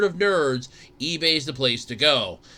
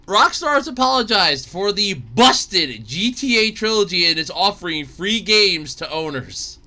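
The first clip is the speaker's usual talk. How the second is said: normal talking voice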